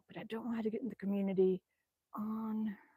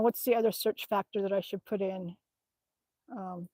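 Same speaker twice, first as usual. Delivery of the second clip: ordinary talk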